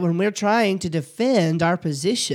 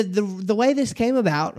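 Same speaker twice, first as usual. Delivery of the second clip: normal talking voice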